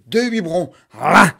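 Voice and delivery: loud, punchy là with each bottle; loud there. Acted. Mother cramming bottles into the monster, loud là.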